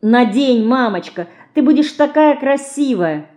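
lightly voiced girls, high and begging; pleading girls. A narrator's reading. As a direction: coax mother like eager little daughters. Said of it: "запросили девочки". (детская мольба, чуть выше голосом)

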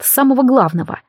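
plain reading voice

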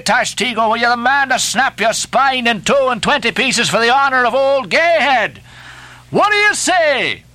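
high, driving exhortation to rowers; urgent harangue, building to a high demand; rallying cry. A narrator's reading. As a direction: goad the crew into pulling. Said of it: "cried Stubb".

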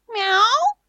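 high innocent cat meow. A narrator's reading. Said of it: "невинно выдал счастливчик".

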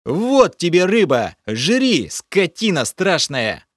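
loud, high furious outburst; furious rasp. A narrator's reading. Hero rages at the creature over the dead fish, furious. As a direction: spit it at him in rage. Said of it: "просипел я".

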